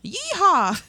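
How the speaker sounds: high whooping yeehaw, loud; cowboy yell. Pretend. Joking about a fart blast, cowboy whoop. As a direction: holler it like a rodeo cowboy.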